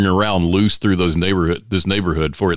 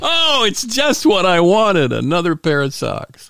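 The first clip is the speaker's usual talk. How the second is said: faking delight at gift; 9 semitones higher than usual. Pretend. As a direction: gush sarcastically over the socks.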